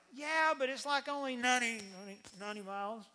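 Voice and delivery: high start, dropping and hurrying through; downplay it, trailing into a quick mumble; Joseph. Acted. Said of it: "And Joseph's like".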